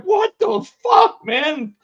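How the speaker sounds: high, slow, loud outraged yell; shocked shout. Retold or imitated. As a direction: yell it out holding a busted nose. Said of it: "he's just like".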